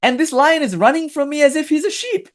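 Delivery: high astonished voice, pitched well up; a lion who can't believe his eyes, voiced high. Guru lion spotting a lion among sheep, astonished high voice. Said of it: "he suddenly noticed".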